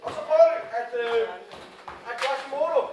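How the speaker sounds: højt råbt regi; råbt instruktion. Real, not acted. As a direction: kald højt ud over scenen. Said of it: "instruktør råber". (højt råbende instruktør)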